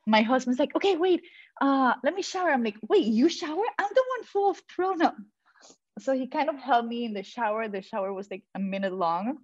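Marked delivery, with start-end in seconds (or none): 0.8-2.5 high, dithering husband voice; husband making excuses, high and flustered; mock flustered husband. Husband's voice imitated. 2.9-5.1 high, incredulous outrage; to husband wanting a shower, high indignant disbelief. Retold or imitated.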